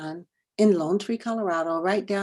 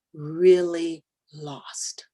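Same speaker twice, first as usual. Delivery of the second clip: whisper; much slower than usual. Shown as a demonstration. Stressing how lost they get.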